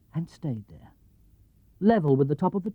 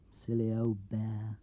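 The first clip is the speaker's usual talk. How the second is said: fond aside; 6 semitones lower, 11 dB quieter, slower than usual. A narrator's reading. Boy thinks it fondly.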